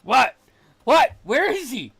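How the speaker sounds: high, very loud startled yells; shouted questions. Acted. Player demands to know where the fallen one is, shouting. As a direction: yell back, demanding to know. Shouting back at the pit call.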